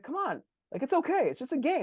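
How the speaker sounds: high, coaxing parent voice; mock parent soothing. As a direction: talk a frustrated kid down, lightly mocking. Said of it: "I'd be like".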